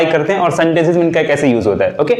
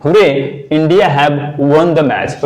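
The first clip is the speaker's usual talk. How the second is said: slow demo; much slower than usual. Shown as a demonstration. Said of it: "अब यहाँ पे example है hurray".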